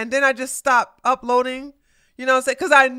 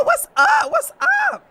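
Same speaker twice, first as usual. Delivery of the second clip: squealed greeting. Real, not acted. Greeting a viewer.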